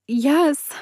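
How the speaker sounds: overjoyed shout of yes; excited scream. Acted. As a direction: burst out with joy. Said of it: "I screamed, my excitement getting the best of me".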